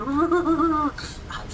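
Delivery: squealing high laugh; high gleeful laugh. Real, not acted. Grabbing the second screen box, squealing with glee. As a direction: let out a delighted squeal.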